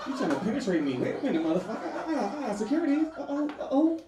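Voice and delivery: high, laughing camp protest; protesting being sat on, hammed-up outrage; mock outrage. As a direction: squeal at your own dick, scandalised. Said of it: "I'm like".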